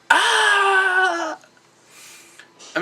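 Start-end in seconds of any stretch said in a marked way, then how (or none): 0.1-1.4 high-pitched excited yelp; excited yell. Retold or imitated. Recalling a great wrestling match, high excited yelp. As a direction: let out a thrilled fan's cry. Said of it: "had me going".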